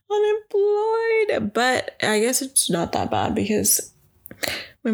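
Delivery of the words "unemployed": high, slow, dragged-out whine on Unemployed; drawn-out whiny repeat. Shown as a demonstration. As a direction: stretch Unemployed into a long mock wail. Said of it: "it sucks to be unemployed".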